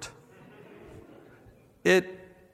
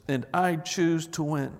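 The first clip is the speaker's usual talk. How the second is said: usual speaking voice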